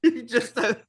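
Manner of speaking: ordinary talk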